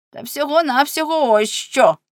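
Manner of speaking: high, theatrical put-on scorn; mocking exclamation. A narrator's reading. Husband showing his wife the case, mocking. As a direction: fling it out high and sarcastic.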